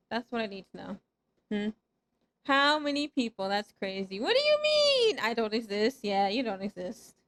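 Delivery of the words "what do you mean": very high, loud squawk of disbelief; high-pitched outraged question; incredulous protest. Real, not acted. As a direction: squeal it back in disbelief. Protesting claim of not existing.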